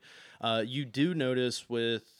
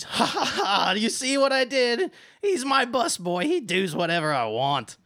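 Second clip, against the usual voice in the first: cruel laughter; 13 semitones higher, 10 dB louder than usual. Acted. Valette laughs and brags about kicking Snips. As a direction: gloat and laugh at him. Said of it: "she starts laughing".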